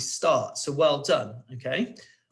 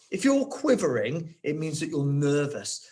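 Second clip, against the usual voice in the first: usual speaking voice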